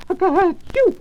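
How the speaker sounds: sudden squeaky achoo; a little rabbit's high sneeze. Acted. Peter sneezes.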